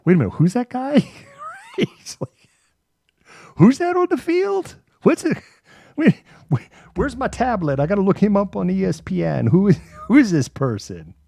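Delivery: high, loud baffled fan squinting at a stranger; hammed-up puzzled fan reaching for his tablet; confused sports fan. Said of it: "it's like".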